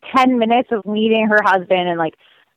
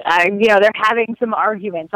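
ordinary talk, nothing marked

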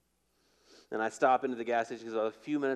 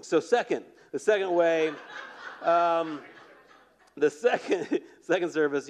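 laughs through the words, louder; laughing; 4 semitones higher, 5 dB louder than usual. Real, not acted. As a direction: gets the giggles mid-announcement. Laughing over running long.

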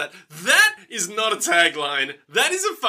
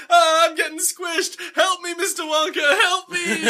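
much higher, drawn-out mock cry for help; mock panic; 11 semitones higher than usual. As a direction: act out people freaking out while squashed. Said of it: "everyone's freaking out".

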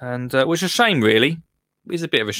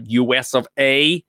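put-on a rubbish American accent, half-hearted; a quick, poor stab at an American accent; 2 semitones lower than usual. Host greets US viewers in a bad American accent. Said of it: "That's, that's a rubbish impression".

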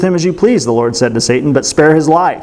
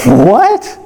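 surprised; 6 semitones higher than usual. Acted. Shocked reaction to Job's pottery.

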